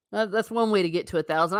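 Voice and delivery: ordinary talk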